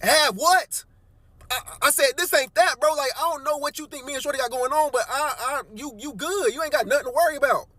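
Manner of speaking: high, pleading incredulous voice; telling the angry coworker he has nothing to worry about, high and flustered; defensive disbelief. Retold or imitated. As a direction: stay up high, flustered and placating. Narrator defending himself to angry coworker.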